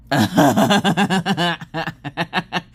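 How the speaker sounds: big burst of laughter; loud laugh. Real, not acted. Laughing at teacher's remark.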